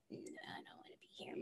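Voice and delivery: barely audible grumble; sulky whisper. Retold or imitated. Sulker at a worship conference, whispered refusal. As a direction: whisper it like a sulking kid. Sulking silently at worship service.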